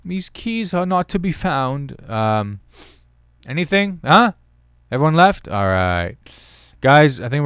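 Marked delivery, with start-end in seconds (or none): 0.0-2.0 slow, high British pronouncement; hammed British butler voice; mock British accent. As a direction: declare it like a butler. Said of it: "get into a British accent".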